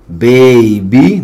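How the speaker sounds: put-on baby said carefully, the final y as a long rising 'ee'; long E ending. Shown as a demonstration. Teacher modelling a final Y for learners, long E sound. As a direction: lift the last 'ee' of baby. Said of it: "va a sonar como E".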